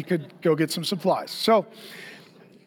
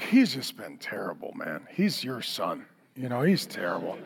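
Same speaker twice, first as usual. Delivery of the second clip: weary mom; 7 semitones lower, 5 dB quieter than usual. Retold or imitated. Exhausted wife complaining.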